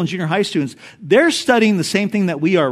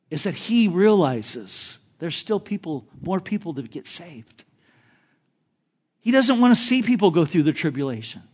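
ordinary talk, nothing marked

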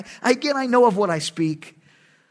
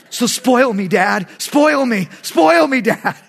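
loud, high pleas, each more insistent; eager begging; 3 semitones higher, 6 dB louder, slower than usual. Acted. Son joking with his dad about the rod, eager begging. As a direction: beg louder and higher with every repeat.

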